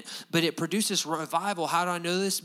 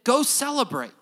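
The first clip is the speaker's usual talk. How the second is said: Nehemiah urging; 8 semitones higher, 5 dB louder than usual. Retold or imitated. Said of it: "He said".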